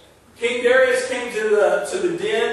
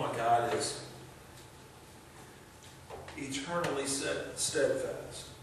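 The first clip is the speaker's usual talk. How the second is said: quiet preaching; 7 semitones lower, 10 dB quieter than usual. Real, not acted. Preacher drawing the congregation close, soft and tender. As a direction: hush the room, like a confession.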